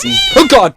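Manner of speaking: shrieking jump scare yelp; startled scream. Real, not acted. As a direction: scream as the cat pops up. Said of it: "that was loud".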